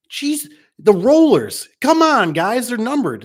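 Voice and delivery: mock outraged tech spotting numbered rollers; exasperated. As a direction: groan it out at a sloppy installer. Exasperated at a botched door install.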